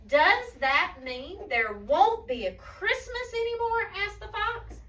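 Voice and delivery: high, slow anxious question; worried fox. A narrator's reading. Fox fearing Christmas is over, high worried voice. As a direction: pitch it up and stretch the worry.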